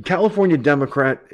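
normal talking voice

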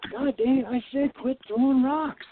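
fed up and telling them off a second time; exasperated. Retold or imitated. Les hit again, turning on his friends, exasperated and high. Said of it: "he turns around and says".

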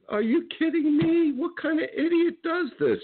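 incredulous outrage. Real, not acted. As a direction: voice jumps up in outraged disbelief. Outraged disbelief at the moron.

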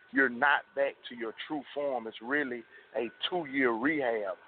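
ordinary talk, nothing marked

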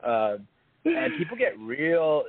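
ordinary talk, nothing marked

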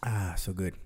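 satisfied sigh after sipping coffee. Real, not acted. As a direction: savor a sip of hot coffee. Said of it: "Let me take a sip of my coffee here".

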